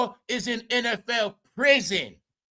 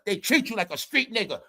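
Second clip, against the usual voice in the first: usual speaking voice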